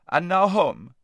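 high, loud command; calling out orders. A narrator's reading. Lazareline sending her slaves home, calling out. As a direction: order the servants off, loudly.

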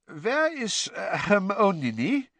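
put-on high, halting, thick foreign accent; slow foreign-accented question; Krum's foreign accent. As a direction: ask hesitantly in a foreign accent. Krum, foreign student, asks after Hermione.

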